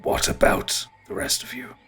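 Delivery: husky, whispery ent voice; voiceless, weighty ent; ancient tree creature. Acted. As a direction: ask it ponderously, like an old tree. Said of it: "Oakensnarl looks at you".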